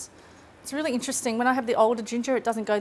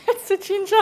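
laughing; 9 semitones higher, 5 dB louder than usual. Real, not acted. Laughing at teary cameraman.

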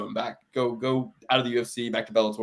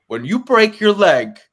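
slow, higher, a weak attempt at Long Island; failed Long Island accent; 8 semitones higher, 9 dB louder, slower than usual. Imitating Weidman's Long Island interview, badly. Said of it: "I can't do a Long Island accent".

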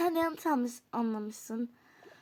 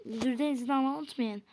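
ordinary talk

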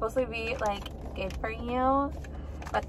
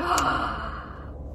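sharp gasp; 7 semitones higher, 3 dB quieter than usual. Retold or imitated. Realizing the surprise plan.

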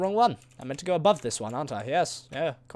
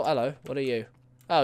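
usual speaking voice

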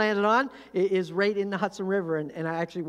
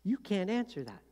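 ordinary talk, nothing marked

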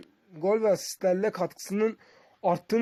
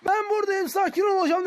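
high-pitched voice for the player; 11 semitones higher than usual. Retold or imitated. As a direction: give the player a lightly comic squeaky voice. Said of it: "dedi bu arada".

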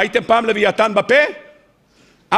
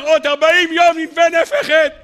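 high-pitched, ringing doom announcement; prophet's proclamation; 6 semitones higher than usual. Acted. Prophet warning Nineveh of its end, proclaiming. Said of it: "מגיע הנביא ואומר".

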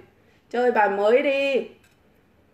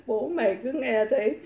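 laughing; 5 dB quieter than usual. Real, not acted. Laughing while speaking.

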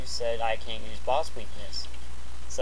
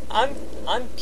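laughing; 8 semitones higher than usual. Real, not acted. Laughing at the remark.

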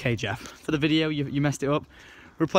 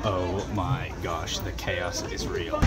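much lower and softer, slow and drawn out; awed mutter; 9 semitones lower, 9 dB quieter, slower than usual. Real, not acted. Vlogger stepping into the chaos, low awed murmur. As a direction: let it fall out of you, stunned. Stunned by smoky ferry deck.

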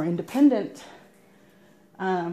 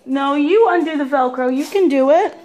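encouraging; 5 semitones higher, 6 dB louder than usual. Real, not acted. Mom urging child with Velcro.

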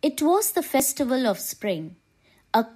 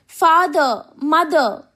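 high, loud call that falls away; narrated child's loud call; loud cry; 5 semitones higher, 5 dB louder, slower than usual. A narrator's reading. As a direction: cry out loudly for your parents. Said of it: "cried in a loud voice".

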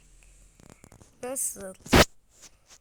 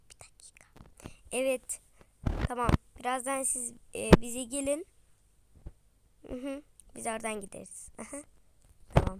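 ordinary delivery

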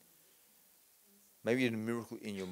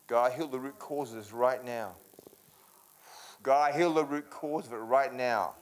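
fervent preaching prayer; 5 dB louder, slower than usual. Real, not acted. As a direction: declare it over him with full force. Commanding prayer again.

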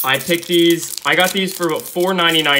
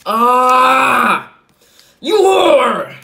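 higher, loud playful rage; mock angry yell; 9 semitones higher, 9 dB louder, much slower than usual. Real, not acted. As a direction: yell at the card in fake fury. Bad pull, yells at card.

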